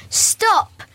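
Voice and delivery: high storybook shout. A narrator's reading. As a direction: call out a sudden high stop. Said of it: "shouted Harry".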